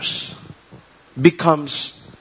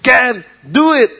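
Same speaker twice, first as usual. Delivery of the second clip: slow and loud, pumping up a crowd; hammed-up pep-talk guru; mock motivational speaker; 7 semitones higher, 5 dB louder, slower than usual. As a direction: sell it like a paid life coach. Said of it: "People pay thousands outside for people to say".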